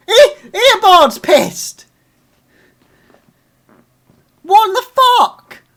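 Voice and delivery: high, loud, slow outraged yell; furious shout. Real, not acted. Fan raging at the episode, furious yell. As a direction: let the rage explode. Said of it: "I'm so ang".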